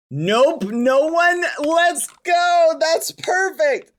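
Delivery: high, loud cheer of victory; real thrilled shout; triumphant. Real, not acted. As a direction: gloat happily. Delighted nobody guessed.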